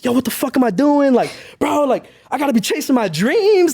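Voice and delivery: high-pitched exasperated outburst, no real scream; mock-yell it at yourself, pitched well up; retold screaming to himself. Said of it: "I'm just screaming to myself".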